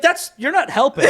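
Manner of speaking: laughing protest. Retold or imitated. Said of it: "I'd go like".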